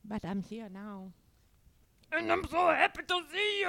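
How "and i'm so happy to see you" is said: excited dog voice. Pretend. Repeats the dog voice.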